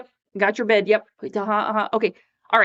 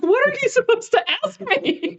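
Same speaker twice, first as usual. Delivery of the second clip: very high mock-baffled question; retold bewilderment; 3 dB louder than usual. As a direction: ask the kid as if totally lost, voice shooting up.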